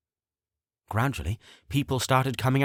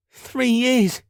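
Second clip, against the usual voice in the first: tearful gasp; 13 semitones higher, 6 dB louder than usual. Acted. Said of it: "he gasped through tears".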